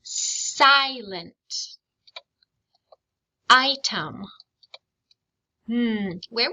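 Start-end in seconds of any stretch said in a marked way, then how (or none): 0.0-4.2 Silent and Item each cut into clear, separate syllables; syllables spelled out. Shown as a demonstration. Teacher models two-syllable words for the class. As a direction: let every syllable land on its own. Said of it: "I'm purposely saying them so you can hear the syllables".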